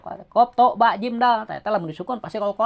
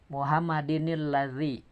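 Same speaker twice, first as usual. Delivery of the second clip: whisper; 7 semitones lower, 8 dB quieter, slower than usual. Real, not acted. Unvoiced example.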